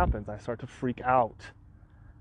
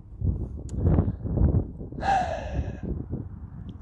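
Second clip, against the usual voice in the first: heavy weary sigh. Real, not acted. Website down, stressed owner.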